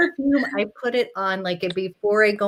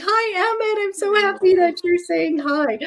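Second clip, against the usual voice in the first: tender greeting to son; 12 semitones higher than usual. Retold or imitated. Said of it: "I'm like".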